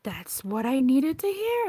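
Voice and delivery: loud, slow mock exclamation, climbing high; imitated amazed viewers. Reader quoting viewers of old readings, loudly amazed. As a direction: draw it out like an astonished fan.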